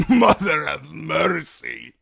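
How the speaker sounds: gasping Dornishman. Acted. Timion dying, crying out in pain. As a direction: gasp it out as a dying man. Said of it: "the Dornishman gasped".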